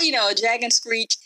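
usual speaking voice

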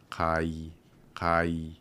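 kai said slowly as ka-i; correct Hawaiian kai. Shown as a demonstration. Host repeats the correct form slowly for listener. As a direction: draw out kai, ah then ee. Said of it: "But we want".

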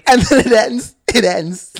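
high, loud incredulous exclamation; hammed-up outrage, easing slightly on the repeat; exasperated outburst. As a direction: shout it in disbelief at the ending. Outraged at the abrupt ending.

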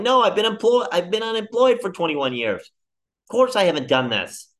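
indignant, voice jumping up; exasperated outburst. Retold or imitated. Job seeker protesting to cranky clerk, high and exasperated. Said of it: "And you're like".

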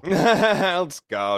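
laughing out the words; cracking up while saying Let's go. Real, not acted. Bursts out laughing at own slip.

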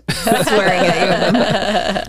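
slow words through laughter; laughing. Real, not acted. Laughing while speaking.

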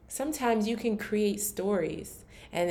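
ordinary talk

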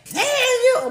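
high mock shriek; siren-like shriek. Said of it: "We sound like a little, uh, siren".

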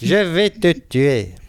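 slow, menacing cartoon villain. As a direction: drag out the threat like a cartoon baddie. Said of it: "la fameuse voix du méchant de Nicky Larson".

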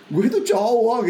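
acted heartfelt announcement; testifying man. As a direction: proclaim a new realization. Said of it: "waktu kesaksian di akhir retreat".

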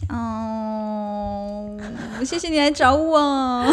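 slow, stretched syllables; drawn-out. Acted. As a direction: stretch every word out long. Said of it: "好像不用拉那么长啦".

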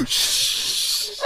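long voiceless mock shush; drawn-out hissed "shh"; group shushing. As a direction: imitate a whole bus hushing. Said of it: "the whole bus starts going".

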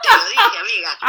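high, loud laughing told-you-so; laughing triumph. Real, not acted. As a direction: crow it through a laugh. Triumphant laugh at husband's answer.

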